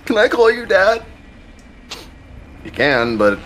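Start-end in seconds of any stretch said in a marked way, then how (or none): 0.1-1.0 high-pitched imitation of someone asking; mocking high-voiced impression of a needy teammate; mock pleading kid. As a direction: put on a high, silly little voice. Mimicking teammate's request to call him dad.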